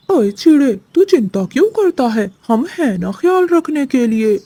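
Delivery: high, sneering crow voice with a leering edge; mocking crow. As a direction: taunt the little sparrow like a greedy crow. Crow mocks the sparrow.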